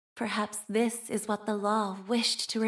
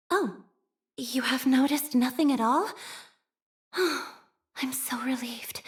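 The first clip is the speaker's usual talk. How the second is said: relieved sigh; 3 semitones higher than usual. Acted. Relieved after secret unnoticed.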